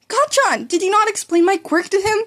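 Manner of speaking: high, sing-song needling; teasing. Acted. Midoriya teasing his rival in front of the hero, high teasing. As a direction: tease him sweetly. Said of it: "a teasing undertone".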